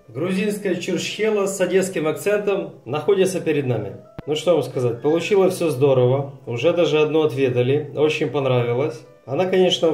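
usual speaking voice

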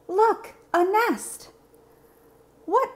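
high, slow, wide-eyed; a child spotting a nest; delighted discovery. Acted. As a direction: point it out with wonder.

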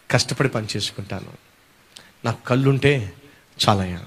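soft, low humble begging; humble plea. Acted. Blind man pleading to Jesus, soft humble begging. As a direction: plead softly like a blind beggar.

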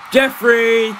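loud, high summoning call. Acted. Streamer summoning his co-host, calling out. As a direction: call the name out far and high.

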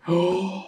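drawn-in gasp of pretend fright; hammed-up startled gasp; mock gasp. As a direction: gasp as if spotting a spider. Fake spider on listener's shoulder.

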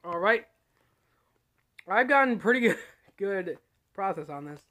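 talking between bites; chewing while speaking; mouth full, chewing. Real, not acted. Seen 0: Bitten candy apple in hand; lips pressed, chewing between bites throughout.

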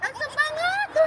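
high squeaky sour-face reaction; eating sour orange. Real, not acted. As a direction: wince at the sourness while chewing. Just tasted the sour orange. (kecut, meringis)

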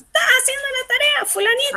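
quick, high-pitched motherly question; mother calling child. Acted. Mother to fulanito about homework, high and quick calling. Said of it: "No, mamá".

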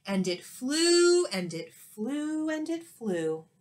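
slow, fading repetition; drawn-out wonder. A narrator's reading. Narrator marveling at the airplane's flight, slow and fading. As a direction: let each flight float longer.

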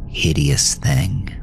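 ordinary delivery

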